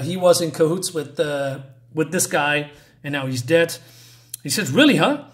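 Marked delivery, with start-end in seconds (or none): 4.7-5.2 a sudden higher, louder "really, huh?"; mock surprise. A villain reacting to news of a death with loud surprise. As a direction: toss it off in disbelief. Villain voiced reading comic dialogue.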